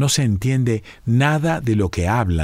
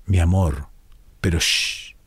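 whispering over a crib; 6 semitones lower than usual. Acted. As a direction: hush her tenderly, almost voiceless. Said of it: "El papá le tapa la boca".

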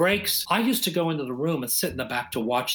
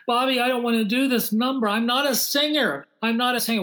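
higher, complaining Gwen voice, pleading; imitating Gwen complaining; 7 semitones higher than usual. Gwen begs Bobby to drop her number, higher and pleading. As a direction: whine it like a star who won't sing. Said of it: "she just said".

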